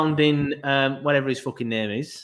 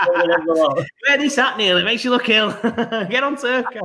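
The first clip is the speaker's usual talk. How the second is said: laughing; 7 semitones higher than usual. Real, not acted. Host laughing at co-host's look.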